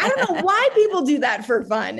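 high, laughing quote of her husband; laughing imitation. Wife quoting her husband's disbelief, laughing and high. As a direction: laugh through his words. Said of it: "He's like".

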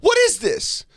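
imitating his mom. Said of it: "my mom's like".

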